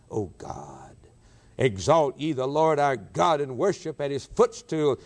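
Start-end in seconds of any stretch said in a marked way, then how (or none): 0.1-1.0 whispered low reverent cry; a whispered prayer. Real, not acted. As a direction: breathe the name in awe. Prayer quote ends in whisper.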